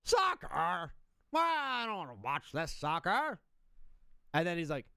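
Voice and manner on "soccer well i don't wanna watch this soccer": put-on slow, grumbling old-man complaint; mock dad who hates soccer; imitating a grumpy dad. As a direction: drag out soccer like you're disgusted by it. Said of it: "Your dad was like".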